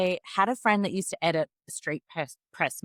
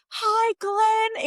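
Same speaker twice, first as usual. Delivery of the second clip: starstruck hello; 3 dB louder than usual. Retold or imitated. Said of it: "And I said".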